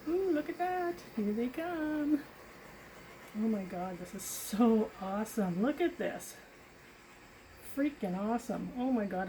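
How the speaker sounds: thrilled, rising delight; gushing over the result; excited. Real, not acted. Paint cells appear, she gushes.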